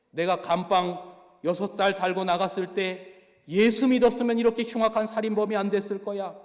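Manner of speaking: loud and high throughout, a hot-tempered scolding sermon; retold killer loudly scolding a prisoner with scripture; angry tirade. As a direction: tear into the know-it-all prisoner. Go Jae-bong rants at a prisoner.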